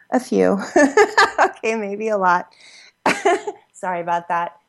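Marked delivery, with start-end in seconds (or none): 0.6-1.3 a quick, very high embarrassed giggle; real sheepish laugh. Real, not acted. Embarrassed at talking so long. 3.0-3.5 a quick, very high embarrassed giggle; real sheepish laugh. Real, not acted. Embarrassed at talking so long.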